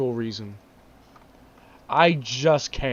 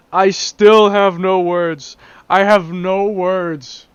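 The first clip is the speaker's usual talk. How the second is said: louder, slow, drawn-out disbelief; exasperated; 3 semitones higher, 8 dB louder, slower than usual. Real, not acted. Vlogger baffled by a car model, slow exasperated outburst. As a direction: spell out each word in exasperation.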